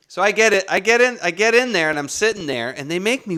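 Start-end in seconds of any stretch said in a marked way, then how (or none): none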